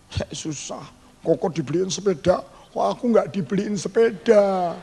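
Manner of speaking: put-on slow, whining kid's grievance; sulky child. Boy complains to adults about no bicycle, whining. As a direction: whine about the bike.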